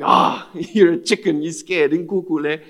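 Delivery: loud, laughing imitation of a taunt; lightly mock him as a chicken. Friend standing there mocking the scared translator, playful taunt. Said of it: "standing there mocking him".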